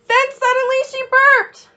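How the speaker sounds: put-on spring the surprise on the kids; high startled storyteller voice; sudden surprise. A narrator's reading.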